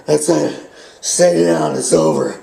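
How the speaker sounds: winded and spent, words pushed out between breaths. Retold or imitated. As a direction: gasp it out like after five minutes of fighting. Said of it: "That's what I was really like".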